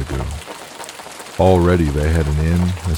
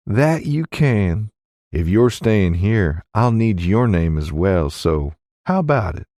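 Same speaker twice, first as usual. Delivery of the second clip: teasing innkeeper woman; 4 semitones higher than usual. Acted. Innkeeper woman asks his name.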